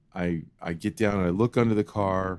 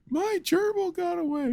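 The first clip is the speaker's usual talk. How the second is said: much higher, wailing sob; imitating crying child. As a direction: wail it like a heartbroken kid. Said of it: "and I'm crying".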